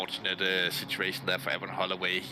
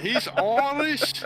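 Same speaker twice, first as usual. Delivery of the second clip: much higher, slow, cartoonish Irish voice; exaggerated Irish impression; 6 dB louder, much slower than usual. Mocking the Irish driver in a squeaky Irish voice. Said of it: "he sounds like this".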